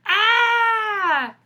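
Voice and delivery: high loud shriek. Acted. Wolf landing in boiling stew, high loud shriek. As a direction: scream as if landing in hot stew. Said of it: "I shrieked".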